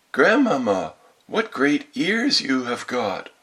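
put-on high, stretched-out little-girl wonder; greatly amazed. A narrator's reading. Girl marvelling at grandmother's ears, greatly amazed. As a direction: draw it out in astonishment. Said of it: "being greatly amazed".

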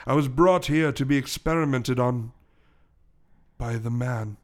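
measured storytelling with long pauses; English gentleman gorilla. Acted. Gorilla recounting his past to the detective, measured English voice.

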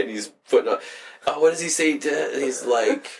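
normal talking voice

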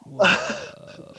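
breathless whispered whoa; a hushed gasp of wonder; awed whisper. Real, not acted. Awed reaction to the pitch.

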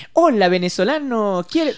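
lightly mocking, slow patronizing hello; a hint of talking down; condescending greeting. Someone patronizing a Venezuelan, lightly mocking tone. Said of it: "venga alguien y me diga".

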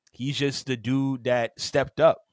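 ordinary talk

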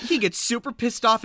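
ordinary talk

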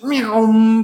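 drawn-out "meow" engine zoom; imitated zooming whoosh. Man sprinting off, imitated zoom. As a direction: mimic a speeding whoosh.

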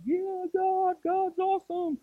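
high, giddy praise; mock hyped-up cheer. Pastor mocking conference hype, high and giddy. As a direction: gush like a pumped-up camper. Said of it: "And you're like".

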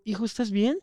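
a soft, caring hint of a grandmother; old lady. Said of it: "llegó y me dijo". (un toque de viejita preocupada, más suave)

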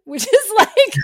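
high, loud laughter; laughing. Real, not acted. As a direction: laugh openly at the burn. Laughing at the clerk's suggestion.

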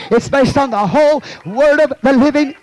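building to a preacher's shout; impassioned preaching. Real, not acted. Preacher declares the Word to his church, impassioned shout. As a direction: drive it up to a shouted finish.

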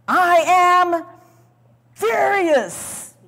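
high, loud angry cry; mock furious ruler; furious outburst. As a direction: hurl it at them in rage. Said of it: "you know, maybe".

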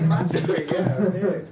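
laughing loudly. Real, not acted. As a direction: laugh through the words, embarrassed. Audience member laughing loudly.

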